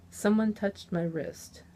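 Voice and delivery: ordinary delivery, nothing marked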